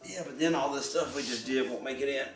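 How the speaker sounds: usual speaking voice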